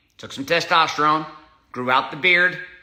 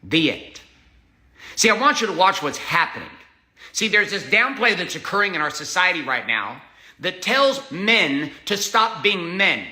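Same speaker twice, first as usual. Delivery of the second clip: ordinary talk, nothing marked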